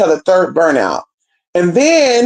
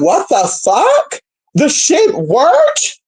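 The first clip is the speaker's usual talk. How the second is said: disbelief; 8 semitones higher, much slower than usual. Retold or imitated. Disbelief spell worked.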